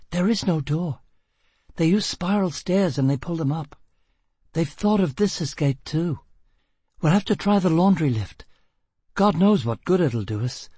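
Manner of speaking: high-pitched woman's voice; tense woman fugitive. A narrator's reading. Woman tells her partner the escape is blocked, tense. Plays Gisbella explaining the trap.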